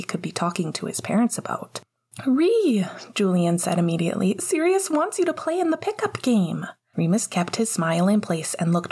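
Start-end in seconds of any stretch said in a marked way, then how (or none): 2.2-2.9 put-on high, eager kid's call; little boy calling. A narrator's reading. Child calls his brother. 4.4-6.7 put-on high, bubbly kid voice; excited little boy. A narrator's reading. Child announces excitedly.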